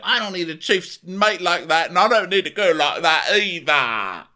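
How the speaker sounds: high, loud mock rant; mock angry rant. Host imitating Tom ranting at the fridge, loud and high. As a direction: rant like a sulking boss.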